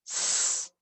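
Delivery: unvoiced s hiss; just hiss an s. Shown as a demonstration. Teacher showing the z sounds like s, a hiss. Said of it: "It sounds like".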